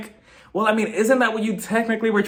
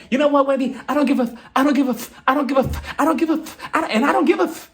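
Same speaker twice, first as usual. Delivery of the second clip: building mock rant, climbing higher and higher; hammed-up tantrum that keeps rising; imitating a housewife losing it, rising pitch; mock meltdown; 8 semitones higher, faster than usual. Robin's escalating outburst at Wendy.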